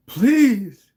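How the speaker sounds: high loud pleading "Please"; begging. Acted. Wounded Cassiel begging Bonnie to stop, pleading. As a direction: beg for mercy, pitch up high. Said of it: "he begs".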